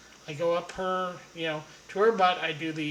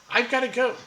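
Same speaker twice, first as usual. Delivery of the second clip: urgent exit; 6 semitones higher, 7 dB louder, faster than usual. Retold or imitated. Trying to escape her.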